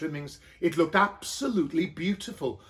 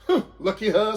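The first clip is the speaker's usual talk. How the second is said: usual speaking voice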